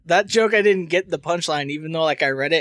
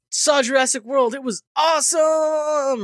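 mock gushing; 9 semitones higher than usual. Mock tweet gushing about movie.

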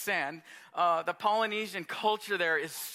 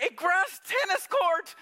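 high, slow, astonished "A grass tennis court."; amazed; 10 semitones higher, 4 dB louder, slower than usual. Real, not acted. Pastor reliving the estate tour for the congregation, amazed. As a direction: marvel at "tennis court" as if still unbelieving.

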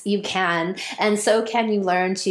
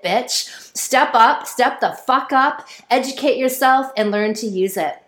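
normal talking voice

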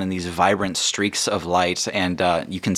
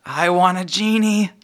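put-on child voice; 10 semitones higher, 3 dB louder than usual. Kid voiced by GM.

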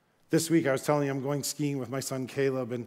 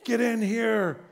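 calling from below; 8 semitones higher than usual. Retold or imitated. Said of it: "he's calling from below".

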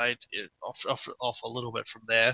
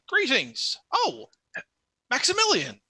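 lightly voiced knight, much higher; a friendly knight greeting an old comrade, higher pitch; NPC greeting; 11 semitones higher than usual.

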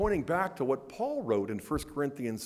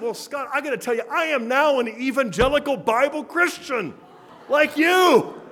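rising, louder, proud declaration that builds to a laugh; he beams as he announces it; excited announcement; 8 semitones higher, 8 dB louder than usual. Retold or imitated. Old friend proudly announcing his conversion.

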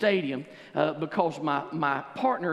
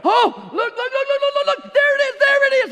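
much higher and louder, shouting with wonder; ecstatic magi; 12 dB louder than usual. Acted. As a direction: cry out at the star. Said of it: "they look up and go".

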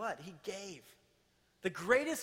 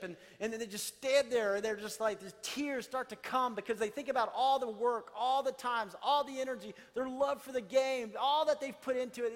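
higher and louder, swelling with passion; fervent preaching; 5 semitones higher, 4 dB louder than usual. Acted. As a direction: preach it up, building toward the tears. Building passionate preaching section.

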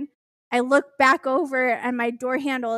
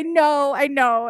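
near-tears protest; 3 semitones higher, 7 dB louder than usual. Retold or imitated. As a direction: repeat it loudly, imitating someone about to cry. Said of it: "starting to cry".

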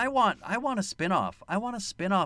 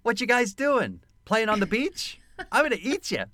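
put-on high, matter-of-fact young crocodile; 7 semitones higher than usual. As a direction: play a young, blunt hungry croc. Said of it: "it's very young and matter of fact".

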